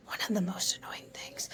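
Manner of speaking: breathy whispered aside, barely voiced. Real, not acted. A mother whispering to her host about her kid. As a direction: drop to a whisper for the confession.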